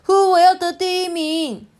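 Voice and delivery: very high, loud character voice; eager shout. Acted. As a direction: declare it like an overexcited child in a race. Eggplant races to be first.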